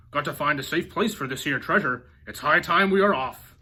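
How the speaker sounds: loud pirate announcement; announcing. A narrator's reading. Braidbeard announces departure, loud call. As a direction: call it out to the whole ship. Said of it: "he announced".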